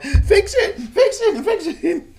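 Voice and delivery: high, loud mocking fix it with laughter; shrill laughing mimic of June demanding. Recapping June bossing Virginia, high laughing mock. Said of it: "she let June push her around".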